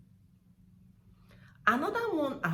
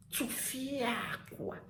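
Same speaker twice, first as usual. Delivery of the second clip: soft, very slow Tufiakwa, "fia" stretched; demonstrated exclamation; 3 semitones higher, 5 dB quieter, much slower than usual. Shown as a demonstration. Repeating Tufiakwa for viewers, slow and drawn out. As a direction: drag out Tufiakwa softly. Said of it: "You say Tufiakwa".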